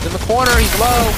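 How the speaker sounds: loud, high, urgent callout; excited callout. Real, not acted. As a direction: shout the enemy position to the team. Calling out an enemy position.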